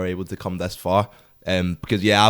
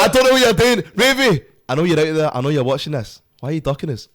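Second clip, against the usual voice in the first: raised, higher-pitched mock challenge to a rival; teasing callout shouted at the camera; 6 semitones higher, 4 dB louder than usual. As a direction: call him out like a playful boxing taunt. Calling out Ravy on camera.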